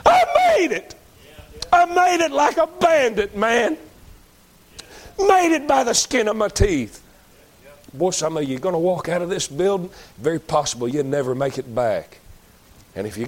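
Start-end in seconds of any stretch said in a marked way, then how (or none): 0.1-7.0 much higher, louder, overjoyed saved thief; joyful whoop. Acted. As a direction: shout it like you just escaped by a hair. Saved thief arrives in heaven.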